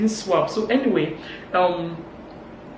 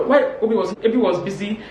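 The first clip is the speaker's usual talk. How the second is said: ordinary talk, nothing marked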